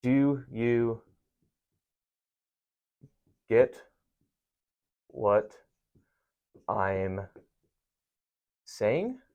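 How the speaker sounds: slow, word-by-word dictation; reading an example aloud. Shown as a demonstration. Teacher dictating an example to the class, slow and deliberate. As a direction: spell each word out slowly as you write. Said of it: "here's a good example".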